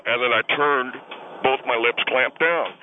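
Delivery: ordinary talk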